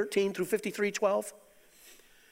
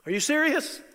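high, loud, incredulous question; playing Joy's astonished reaction for the crowd; amazed disbelief; 9 semitones higher, 4 dB louder than usual. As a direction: throw it up high in wide-eyed wonder. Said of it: "He's in love with you".

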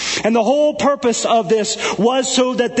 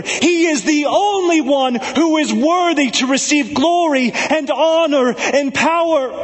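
full-force preaching; 8 semitones higher than usual. Acted. Preacher urging despairing listeners to hear God's glory, fervent. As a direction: lift the room with a stretched, ringing cry.

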